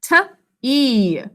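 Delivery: "T, E" as a short t, then long ee; "T, E" split apart with a gap; separate letter sounds. Shown as a demonstration. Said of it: "what do you hear?".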